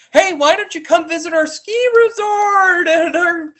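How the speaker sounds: high mock-cheery resort lady voice; mocking the lodge owner. Mocking the resort owner who invites guests despite a death, high fake-cheerful. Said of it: "I got mad at her. I was like".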